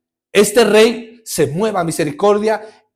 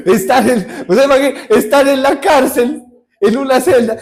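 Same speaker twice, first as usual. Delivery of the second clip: laughing; 4 semitones higher than usual. Real, not acted. Preacher laughs at own joke.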